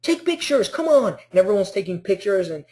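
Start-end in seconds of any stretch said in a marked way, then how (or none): 0.0-1.2 much higher, hurrying the photographers; bossy host. Retold or imitated. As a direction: urge the cameras on excitedly. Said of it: "he's like".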